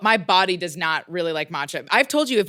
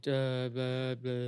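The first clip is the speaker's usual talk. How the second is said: dead voice; 7 semitones lower, 11 dB quieter, much slower than usual. Shown as a demonstration. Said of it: "like, dead voice where I'm like".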